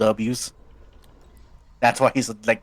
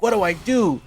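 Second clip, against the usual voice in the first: high, loud panicky question; panicked Sonic; 9 semitones higher, 4 dB louder than usual. Acted. Sonic helpless against the tyrant, high panicked. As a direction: cry out for help, high. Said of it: "He's like".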